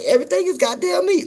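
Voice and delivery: mock Southern pitch; Southern phrase. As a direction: squeal it like the locals.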